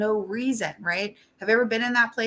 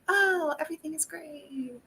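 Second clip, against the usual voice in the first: high, bright mask of fake cheer, softer; fake cheerful; 8 semitones higher, 6 dB quieter than usual. Tired mom hiding tears from her littles, forced high cheer. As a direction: paper over the crying with forced cheer. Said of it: "just being like".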